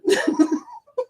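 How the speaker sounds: high laugh. Real, not acted. Laughing at chat joke.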